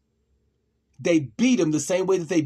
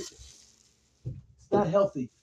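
mock tearful; 3 semitones higher, much slower than usual. Tearful repeated lament.

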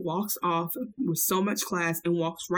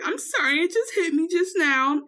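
New York voice; 8 semitones higher than usual. Retold or imitated. Voicing New York crying.

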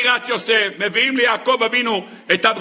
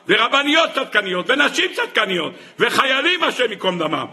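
normal talking voice

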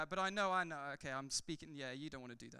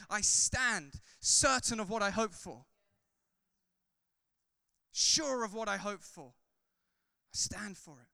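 loud, driving sermon delivery, louder; full-force preaching; 4 semitones higher, 9 dB louder than usual. Acted. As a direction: preach it out to the whole room. Preacher builds again.

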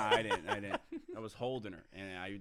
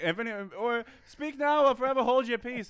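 loud, high mock-solemn priest; pompous officiant; 13 semitones higher, 11 dB louder than usual. Friends imagine the officiant at the wedding, mock-solemn and loud. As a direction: intone it like a hammed-up wedding officiant. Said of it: "They'd be like".

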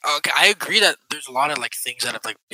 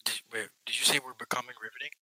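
whisper; 9 dB quieter, faster than usual. Real, not acted. Teasing whisper repeat.

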